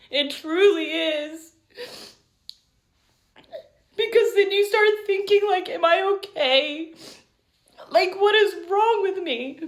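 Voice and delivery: choked up, high and close to crying; real tearful emotion. Real, not acted. Wife confessing self-doubt about living with ADHD, tearful. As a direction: let the voice climb as the tears come. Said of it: "Sorry, I'm so emotional right now".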